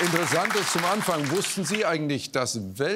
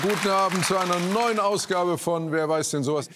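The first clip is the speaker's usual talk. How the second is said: upbeat host announcer voice, louder and higher; TV show opening; 5 semitones higher, 5 dB louder than usual. Acted. Quiz host greeting viewers at home: upbeat announcer. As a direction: welcome the audience like a game show host.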